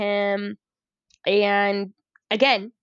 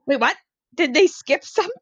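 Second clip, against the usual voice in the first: higher, louder puzzled wait what; mock confusion; 6 semitones higher, 4 dB louder than usual. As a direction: pretend bafflement with a laugh. Retold confused reaction.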